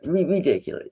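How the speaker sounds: usual speaking voice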